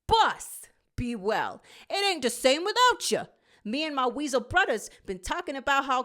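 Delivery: loud cocky weasel letter; Brutta's voice. A narrator's reading. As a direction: swagger like a tough street weasel. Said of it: "hearing Brutta's voice".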